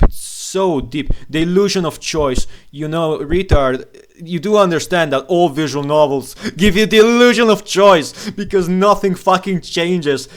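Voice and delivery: loud, high-pitched angry tirade; exasperated rant. Real, not acted. Ranting at fans of visual novels, exasperated.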